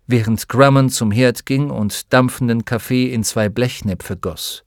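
ordinary delivery

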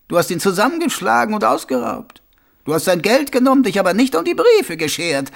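captain relishing his case, pitch swinging high; triumphant captain. Acted. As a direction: build the accusation, then draw out the final reveal. Captain triumphantly corners the prisoner. (triumphierender, anklagender Hauptmann)

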